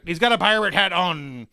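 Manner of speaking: lightly kept-up pirate voice. Pretend. Describing the label to co-host, light pirate voice. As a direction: stay a bit in pirate character. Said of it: "say it like a pirate".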